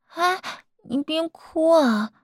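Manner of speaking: high, drawn-out plea not to cry; alarmed soothing. Acted. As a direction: fuss over a crying partner. Partner starts crying, speaker soothes.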